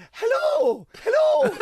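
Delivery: high, loud, overexcited radio host greeting; mock shouted hello. Radio host shouting hello down the line. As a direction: yell it like a hyped-up DJ. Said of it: "like this".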